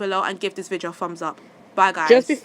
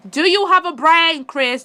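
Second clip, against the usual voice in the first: louder and higher, slow and cutting; exasperated scolding; 7 semitones higher, 6 dB louder, slower than usual. Real, not acted. Vlogger scolding Chris at the camera, loud exasperated. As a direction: demand it like he should know better.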